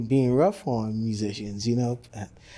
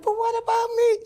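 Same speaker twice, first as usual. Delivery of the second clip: very high, plaintive cry; his nerves pleading as they leave; whiny squeal; 4 dB louder than usual. Retold or imitated. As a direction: squeak it like an abandoned child. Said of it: "It's like".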